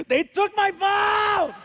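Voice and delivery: put-on drawn-out, high-pitched teenage wail; anguished yell. Son not getting his way, yelling at the ground. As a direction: scream it slowly as a melodramatic tantrum. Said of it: "he yells at the ground".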